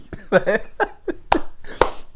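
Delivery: high breathless giggle rising at the end; breathy giggle. Real, not acted. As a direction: dissolve into a silent, rising giggle. Laughing at own impression.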